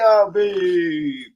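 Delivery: high, stretched-out name call; sing-out shout-out, stretched; drawn-out call. Real, not acted. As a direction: hold the name long and high.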